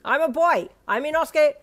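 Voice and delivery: high, loud indignant voice; a boar-masked boy correcting the stranger; high loud boasting. A narrator's reading. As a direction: shout it, offended and proud. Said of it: "you screeched".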